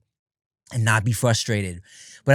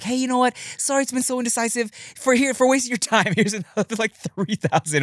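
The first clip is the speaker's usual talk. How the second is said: higher, lightly voiced apologetic client; mock apologetic company; 10 semitones higher than usual. Company apologizing to a freelancer, gushing and higher. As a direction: play the gushing sorry company.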